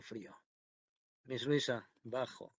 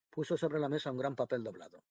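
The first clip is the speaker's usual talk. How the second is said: plain reading voice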